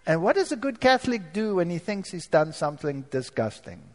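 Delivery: usual speaking voice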